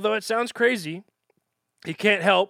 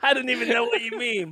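laughing; 5 semitones higher than usual. Real, not acted. Laughing at partner's joke.

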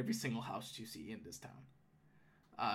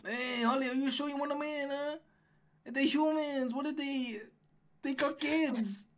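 higher-voiced wary gnome guard; gatekeeper gnome, doubtful; 12 semitones higher than usual. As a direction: question the decision as the gnome guard.

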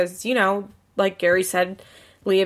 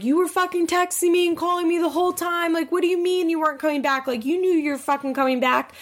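high-pitched, indignant retold rant; flipping out; 9 semitones higher, faster than usual. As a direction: imitate an outraged girlfriend, pitched way up. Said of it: "Amber flips out".

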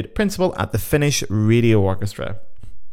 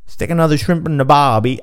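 mock Aussie voice, a bit raised; bad Australian accent; 4 semitones higher, 3 dB louder than usual. Host teasing the winning Australians, in a badly faked Australian accent. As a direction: have a poor go at an Australian accent. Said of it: "I can't do the accent either".

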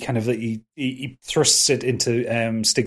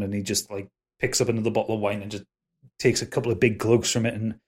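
normal talking voice

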